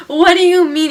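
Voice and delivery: high, loud baffled retort; indignant disbelief. Retold or imitated. Teen answering friend who called them stupid, indignant.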